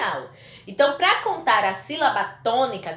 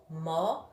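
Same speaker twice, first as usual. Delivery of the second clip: "momento" syllable by syllable, softer, MEN held; stress on MEN; 6 semitones lower, 8 dB quieter than usual. Shown as a demonstration. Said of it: "men é a sílaba tônica". ("momento" pausado, destacando o MEN)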